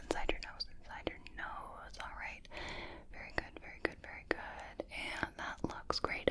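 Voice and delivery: doctor whispers during the ear check, very softly; faint close whisper. Acted. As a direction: breathe the words into the ear.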